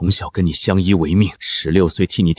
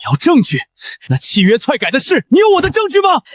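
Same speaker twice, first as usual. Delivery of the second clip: angry shout. Acted. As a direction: fire the question back in fury. Furious retort in family quarrel.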